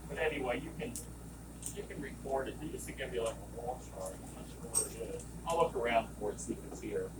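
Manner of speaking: normal talking voice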